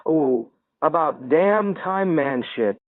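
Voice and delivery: high, drawn-out mock yell; yelling back. A narrator's reading. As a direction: stretch it out like calling back. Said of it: "Bo yells back".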